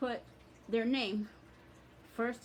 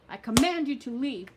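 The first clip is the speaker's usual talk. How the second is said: loud raised furious command; shouted banishing command; angry command; 3 semitones higher, 9 dB louder than usual. Shown as a demonstration. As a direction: order it out with real force. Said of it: "anger, with feeling".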